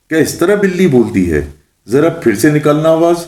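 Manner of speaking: high-pitched, scornful demand; displeased. A narrator's reading. Witch orders Mullah to redo his meow, displeased. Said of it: "چڑیل نے برا سا منہ بنا کر کہا".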